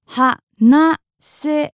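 "Hanasemasu" slowly, a pause after every syllable. Shown as a demonstration. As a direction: spell out "Hanasemasu" in separate beats. Teacher breaks word into syllables.

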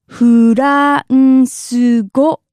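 "France go" as slow Japanese "fu-ra-n-su go"; syllable by syllable. Shown as a demonstration. Teacher stretches word by syllables.

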